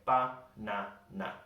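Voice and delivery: ba-na-na slowly, each syllable a full ah; every A pronounced. Shown as a demonstration. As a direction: break ba-na-na into three even, full syllables. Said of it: "They pronounce every single A".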